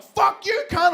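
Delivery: high, loud cursing retort; hammed-up furious comeback; acted angry yell. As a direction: shout back at high pitch. Acted shouted retort in quarrel.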